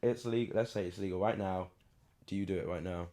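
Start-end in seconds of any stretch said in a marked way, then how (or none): none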